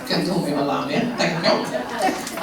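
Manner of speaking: laughing through a cheeky joke. Real, not acted. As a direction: tease him with a laugh in the voice. Flirty joke to audience man.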